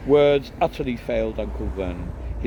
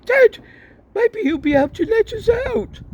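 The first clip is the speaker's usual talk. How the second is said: put-on high, louder lively boy's voice; hopeful, calling to Dad; eager twin; 9 dB louder than usual. Twin hoping Harry hears.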